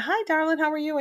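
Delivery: put-on high, sing-song hello to a child; sweet grandma greeting. Grandma greeting her granddaughter sweetly on the phone. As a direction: greet a small grandchild fondly.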